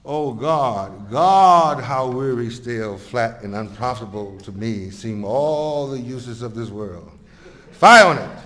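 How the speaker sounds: weary stage soliloquy swelling on the outcries; theatrical verse delivery, bursting out on "God" and "Fire"; dramatic recitation. Acted. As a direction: declaim it like Shakespeare, drawing out the lament.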